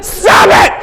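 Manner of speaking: high, loud frantic shout; panicked yell. Retold or imitated. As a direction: beg her to stop in panic. Dad panicking.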